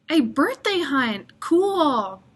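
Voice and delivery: delighted little exclamation; excited. Acted. Boy thrilled by the birthday hunt, excited.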